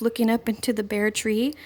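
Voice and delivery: ordinary delivery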